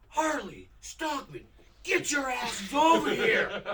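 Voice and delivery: slow, high, loud holler; drawn-out retold coach's yell; shouting head coach. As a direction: summon them slowly across the gym. Head coach calling players over, acted.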